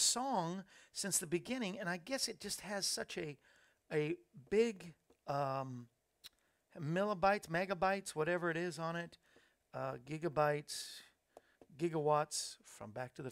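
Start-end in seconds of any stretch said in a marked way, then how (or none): none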